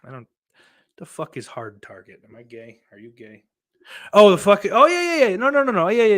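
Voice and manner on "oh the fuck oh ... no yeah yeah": jumps way up high and loud, oh yeah yeah; loud surprised oh-yeah of realizing; sudden realization. Real, not acted. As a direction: snap of recognition, I know that one. Suddenly remembers Hard Target.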